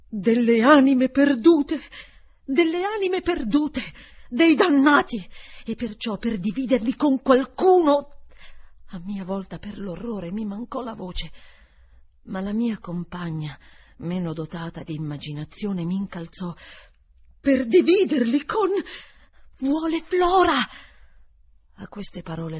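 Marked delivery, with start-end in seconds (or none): 0.0-1.8 stammering slowly and loudly in horror; balbettando, lento. A narrator's reading. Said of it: "balbettò". 2.4-3.8 repeated higher, ending on a rise; balbettando, acuto. A narrator's reading. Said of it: "balbettò". 4.3-8.2 higher and louder, shaken; horrified stammer. A narrator's reading. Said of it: "balbettò". 17.4-20.6 much higher and louder, aghast; horrified outcry. A narrator's reading. Gross shocked, crying out.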